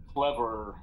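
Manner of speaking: ordinary talk, nothing marked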